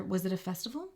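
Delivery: normal talking voice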